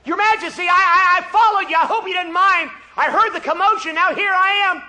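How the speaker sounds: high, loud, hurried eager voice; eager young soldier. Acted. Abishai eagerly reporting to King David, loud and high. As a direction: report in eagerly, a little stammering.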